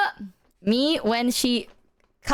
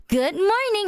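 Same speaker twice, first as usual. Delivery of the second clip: chirpy high good morning; 8 semitones higher, slower than usual. Retold or imitated. Chirpy greeting retold.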